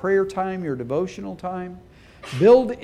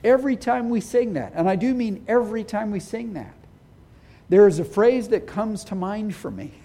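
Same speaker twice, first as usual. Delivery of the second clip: normal talking voice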